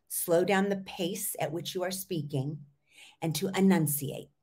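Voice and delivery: ordinary delivery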